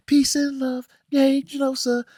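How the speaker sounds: high, sing-song hippie chant; mock hippie cheer. Mocking the show's hippie Genosha, high sing-song. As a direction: chirp it like a blissed-out hippie.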